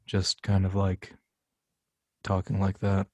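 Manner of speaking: deep, flat, emotionless voice; very deep deadpan delivery; Orville. Shown as a demonstration. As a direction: talk low with no emotion at all. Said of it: "very deep, very flat".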